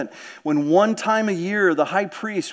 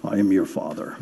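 very low, joking villain line; hammed-up deep, ominous fatherly pronouncement; mock deep movie-villain voice; 11 semitones lower than usual. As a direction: play up the suddenly deep voice for a laugh. Joking deep movie-villain impression after voice dropped.

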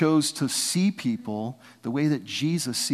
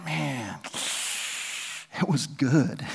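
a hushed, dreamy sigh of wonder; wistful awe; 4 dB quieter, much slower than usual. Retold or imitated. Paul marvelling to his readers about heaven, in hushed awe. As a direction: savour the memory, half lost in it. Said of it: "He's like".

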